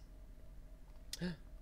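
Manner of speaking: breathy surprised gasp. Acted. Teacher showing children the planets, a wondering gasp. Discovering the telescope.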